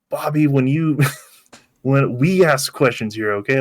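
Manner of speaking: half-hearted angry take on the line; full on angry. Retold or imitated. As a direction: imitate a hammed-up angry agent. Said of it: "he did, like, full on angry".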